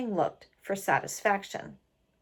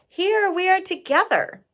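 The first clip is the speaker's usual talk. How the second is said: high, loud pleading wife voice; a wife begging her husband not to go, higher and louder; heartbroken pleading wife; 10 semitones higher, 6 dB louder than usual. Acted. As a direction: plead with him to stay, pitched up. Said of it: "His heartbroken wife pleaded".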